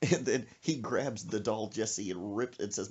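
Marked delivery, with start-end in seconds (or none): none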